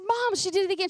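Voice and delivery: high, loud whiny tattle; tattling child. Retold or imitated. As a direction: whine it to mom like a kid. Son tattling on sister.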